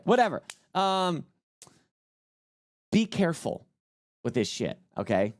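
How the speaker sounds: usual speaking voice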